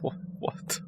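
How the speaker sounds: whispered disbelief. Real, not acted. Stunned whisper.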